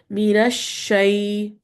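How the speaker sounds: Minash shay with the sh spreading out; spread sheen. Shown as a demonstration. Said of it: "let that voice spread".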